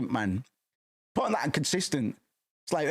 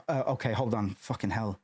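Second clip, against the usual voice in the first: ordinary delivery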